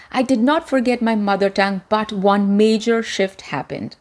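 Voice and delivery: ordinary delivery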